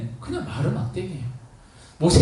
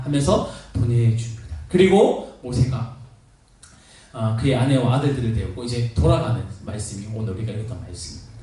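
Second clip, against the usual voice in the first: normal talking voice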